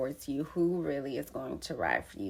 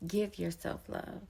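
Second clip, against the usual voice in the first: ordinary delivery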